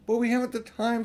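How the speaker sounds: put-on high, loud, urgent girl's voice; worried girl urging haste, pitched high; anxious Susan. A narrator's reading. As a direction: press her to hurry.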